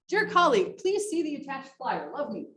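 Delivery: ordinary delivery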